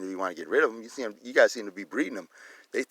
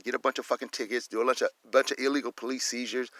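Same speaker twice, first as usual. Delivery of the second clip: ordinary delivery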